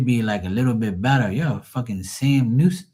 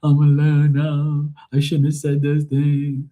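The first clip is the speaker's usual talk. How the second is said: mock mournful mother; 6 semitones higher than usual. Son mimicking mom missing her husband, mock mournful. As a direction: imitate a mother's woeful lament, high and dragging.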